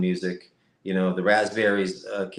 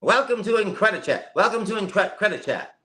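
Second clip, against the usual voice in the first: higher lightly voiced copy of the host; imitating Mike; 9 semitones higher than usual. Guest mimics the host's greeting, lightly voiced copy. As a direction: catch his tone and echo it higher. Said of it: "Now that's what I hear".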